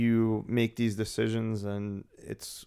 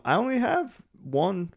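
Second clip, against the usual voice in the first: voice cracking up an octave; 9 semitones higher than usual. Real, not acted. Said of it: "my voice cracked there like I'm a teenager".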